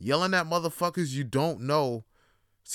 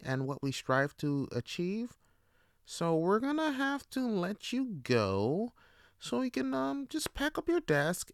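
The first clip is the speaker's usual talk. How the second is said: higher, polite corporate firing voice; HR manager; 5 semitones higher than usual. Retold or imitated. HR tells the employee he is fired, in a higher polite corporate voice. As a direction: let him go with a hollow politeness.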